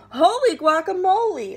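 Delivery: high, loud startled cry; surprised exclamation. Acted. Kitten startled by his own reflection, exclaiming loudly. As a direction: yelp it out in surprise.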